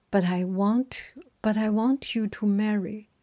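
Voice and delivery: ordinary delivery, nothing marked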